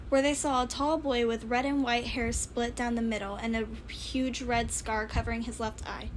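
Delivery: plain reading voice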